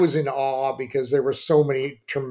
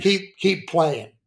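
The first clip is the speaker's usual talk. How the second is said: slowed-down, lightly booming father; a hint of a big billowing dad, taking his time; 2 semitones higher, slower than usual. Dad telling mom to let the boys play, lightly billowing voice. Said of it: "in his big billowing voice".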